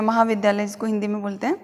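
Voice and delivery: normal talking voice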